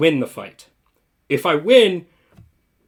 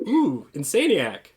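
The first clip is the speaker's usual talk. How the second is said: ordinary talk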